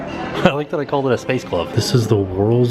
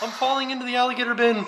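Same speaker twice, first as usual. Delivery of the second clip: mock panic; 13 semitones higher, 6 dB quieter, faster than usual. Joking about alligator exhibit.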